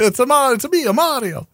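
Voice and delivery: high, playful cartoon Italian accent; Mario's Italian accent. Host riffing on own accent slip, high mock-Italian Mario voice. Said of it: "went with an Italian accent".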